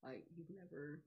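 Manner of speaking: soft, slow resigned sigh; a touch of mourning; quiet grief. Retold or imitated. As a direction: let it sink in, quietly. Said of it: "he was like".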